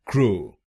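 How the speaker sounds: क्रो with a full long o; wrong syllable. Shown as a demonstration. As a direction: show the wrong क्रो, kro. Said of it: "गलत pronunciation में".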